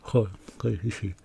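talking through fake teeth; fake buck teeth. Real, not acted. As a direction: mouth full of false teeth. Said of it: "No me puedo reír porque".

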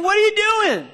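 high loud accusing cry; mock panicked outcry. Israelites seeing Pharaoh's army, high alarmed outcry. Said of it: "they started saying".

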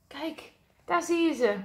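plain reading voice

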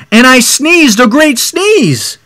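put-on storyteller hamming up the big moment for children; dramatic sneeze line. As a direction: build it up like a huge sneeze. Reads the wolf's big sneeze.

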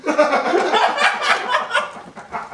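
high laughing outburst. Real, not acted. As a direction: blurt it while cracking up. Cracking up mid-game.

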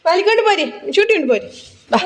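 high call to come along. Real, not acted. Mother calling her son to come along, high call. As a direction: call him over brightly.

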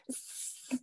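a long unvoiced hissing "S-"; hissed S. Shown as a demonstration. To her quiz partner, demonstrating the sibilant S sound. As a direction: hiss out a drawn-out "S-". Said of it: "that sound when somebody says an S".

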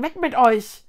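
indignant order to clear off; shouting them away. A narrator's reading. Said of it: "rief die Baumfrau". (laut fortscheuchend)

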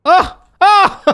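startled yelps. Real, not acted. Falling in game.

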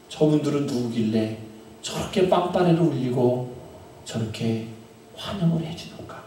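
usual speaking voice

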